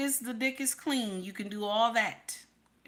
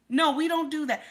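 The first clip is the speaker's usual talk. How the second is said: louder emphatic refusal; firm loud no; 6 semitones higher, 5 dB louder than usual. Real, not acted. Preacher to wives, firm and loud. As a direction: raise the voice, higher and louder, shutting it down. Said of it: "loud insistence".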